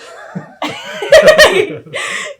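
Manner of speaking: loud high laugh. Real, not acted. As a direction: burst out laughing. Laughing about tub size.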